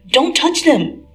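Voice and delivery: loud, slow, high protest; alarmed shout, drawn out; protesting cry. Acted. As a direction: cry out to stop them. Peter protesting about his hair.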